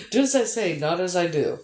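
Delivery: laughing. Real, not acted. Streamer to a viewer with back pain, laughing. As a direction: giggle at your own hypocrisy.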